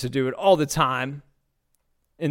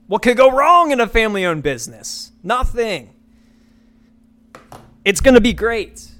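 mocking the scammers; 7 semitones higher, 6 dB louder than usual. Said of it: "but they go".

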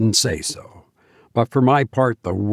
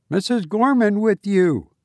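put-on high-pitched young man's voice; lightly voiced high, youthful caller; calling young man; 9 semitones higher than usual. A narrator's reading. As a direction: call the question across to someone hidden. Young Reggie calls to hidden pair.

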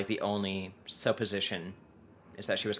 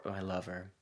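mock lover; 3 semitones lower, 10 dB quieter than usual. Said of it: "I hate that word".

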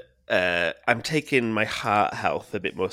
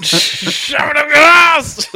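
bellowed slow and much louder, pitched way up; aggressive shout; 13 dB louder, much slower than usual. Acted. Host opening the pod, bellowing an aggressive yelled sign-on. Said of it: "That was so aggressive".

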